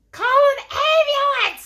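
piercingly high, very loud shriek; screaming. A narrator's reading. Lady interrupts the judge's hearing, screaming. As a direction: shriek for help, over the top. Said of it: "screamed the lady".

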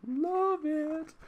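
high drawn-out falsetto "love it"; sing-song delight. Real, not acted. Reviewer savoring a comic's opening quote, high drawn-out delight. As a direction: stretch it out, way up high.

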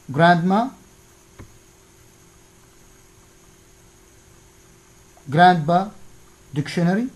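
GRANDma, GRANDpa, DICtionary, each stressed on the first syllable. Shown as a demonstration. Teacher demonstrating stressed syllables for an exercise, first-syllable stress on GRANDma, GRANDpa, DICtionary. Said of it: "the stressed syllable".

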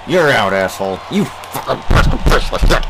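spat-out angry curses, loud; angry outburst. Real, not acted. Player cursing the opposing batter, angry outburst. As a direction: snarl it at the batter. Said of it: "angry outbursts that might come from me".

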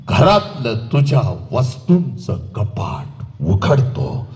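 very slow, low, drawn-out words; grave emotional oration. Acted. Orator tells daughter father cried unseen, grave and slow. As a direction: drop low and draw out every phrase.

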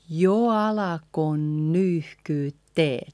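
slow, linked jo alako nyyhkyttee; slow careful teaching model. Shown as a demonstration. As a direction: draw the phrase out for learners. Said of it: "A little bit slower".

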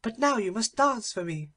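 high, demanding princess voice; a spoilt girl ordering someone to dance. Acted. Infanta demands.

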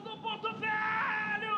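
slow, stretched goal yell; drawn-out goal shout. Real, not acted. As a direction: hold the club name long.

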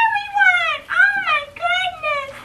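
very high, slow, teary coo; after crying. Real, not acted. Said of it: "Sorry for this voice".

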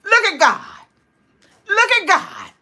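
high, loud burst of testimony; exultant praise. Real, not acted. Praising God for the subscribers, exultant exclamation. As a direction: exclaim it high and punchy, pausing after each burst.

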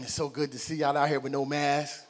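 ordinary talk

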